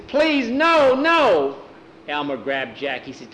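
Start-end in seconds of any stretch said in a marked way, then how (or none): 0.1-1.6 put-on high, loud boyish plea; desperate pleading. Boy begging for his dog's life, high desperate plea. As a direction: beg loudly, pitched right up. Boy begs as dog is dragged off.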